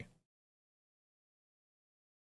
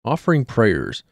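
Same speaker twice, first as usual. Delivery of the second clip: lower, measured document read; lore reading; 5 semitones lower than usual. Acted. Streamer reading cult lore to viewers, measured and low. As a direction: read it like an old dark chronicle.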